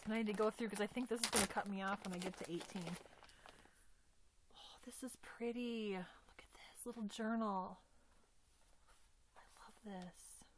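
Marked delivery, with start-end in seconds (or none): 4.5-10.5 hushed whisper of admiration. Real, not acted. Looking at the little journal, hushed whisper.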